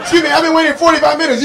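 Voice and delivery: hammed-up annoyed diner; mock complaining customer. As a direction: whine at the waiter impatiently.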